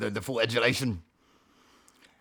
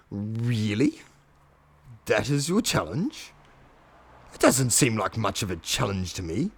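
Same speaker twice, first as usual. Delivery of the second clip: sceptical dragon; 6 semitones lower, 3 dB quieter than usual. Retold or imitated.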